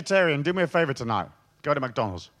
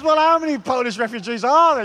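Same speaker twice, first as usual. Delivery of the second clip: higher, louder mock cop; hammed-up quizzing policeman; 8 semitones higher, 7 dB louder than usual. Policeman quizzes the jaywalker about Polish refugees, hammed-up.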